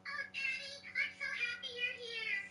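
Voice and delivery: put-on high, soft little girl voice; high childlike Angelica. Angelica greeting her father, high and soft. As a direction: sound like a small girl hugging daddy.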